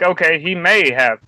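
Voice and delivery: normal talking voice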